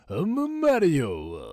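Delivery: put-on high, loud, slow Italian accent; cartoon plumber with an Italian accent; Mario. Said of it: "your Italian voice. It's just as good as Chris Pratt's".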